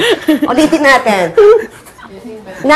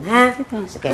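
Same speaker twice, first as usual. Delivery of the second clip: normal talking voice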